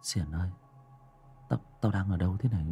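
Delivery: soft, fast, frightened stammer. Acted. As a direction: barely get the words out, shaken. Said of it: "Tấn lắp bắp". (lắp bắp sợ hãi, nói khẽ)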